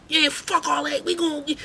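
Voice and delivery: high, put-on taunting voice; mock girls egging on. Girls in the crowd egging on the fight, high mocking voice.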